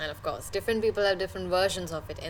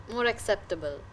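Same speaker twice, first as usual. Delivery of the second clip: ordinary delivery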